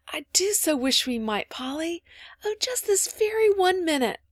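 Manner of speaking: put-on high, eager little-girl longing; rapturous. A narrator's reading. Phronsie begging Polly for candles, rapturous wish. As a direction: wish aloud, climbing into excited pleading. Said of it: "in rapture at the thought".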